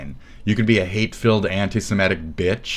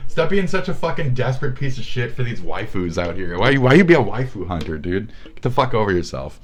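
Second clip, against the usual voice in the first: usual speaking voice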